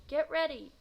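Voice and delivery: loud, high call to start the race; starter's call. Acted. Starter calls the runners to the line, loud. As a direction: bark the start so the whole street hears.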